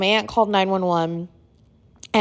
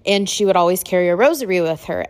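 plain reading voice

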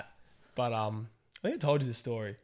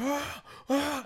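two high mock screams; short high-pitched yelps, acted; imitated screaming. Imitating a man in full meltdown, high screams. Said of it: "He's, like, screaming".

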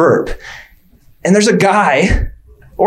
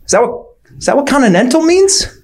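ordinary delivery

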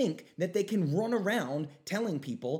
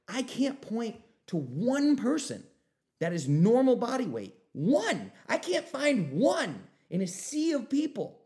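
exasperated inner rant; 6 semitones higher, 7 dB louder than usual. Retold or imitated. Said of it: "and I'm like".

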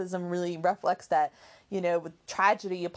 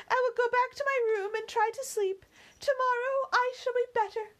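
put-on weary young lady. Emily refusing supper to the servant, high tired voice. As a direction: speak like a grieving girl wanting to be alone.

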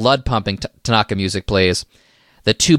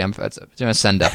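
normal talking voice

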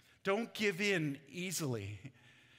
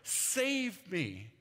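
calling out; 5 semitones higher, much slower than usual. Acted. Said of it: "when he called out".

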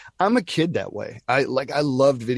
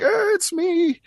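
excited; 3 dB louder, slower than usual. Retold or imitated. Hearing his own voice in game.

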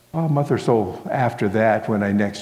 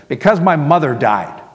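loud, higher, cross retort; curt, irritated outburst; not very nice; 5 semitones higher, 6 dB louder than usual. A narrator's reading. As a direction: throw the answer back unkindly. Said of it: "not very nicely said".

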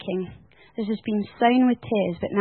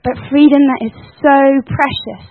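louder, rising into full preaching; fiery preaching; 3 semitones higher, 7 dB louder than usual. Real, not acted. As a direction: proclaim freedom to the church. Preaching climax.

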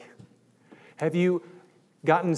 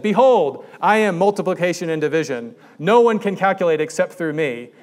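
loud, pompous proclamation from a math teacher; hammed-up godlike teacher booming his claim; a mock prophet voice, louder and grander; grandiose math teacher; 2 semitones higher, 6 dB louder than usual. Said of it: "and then they said".

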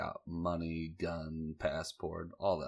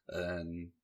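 normal talking voice

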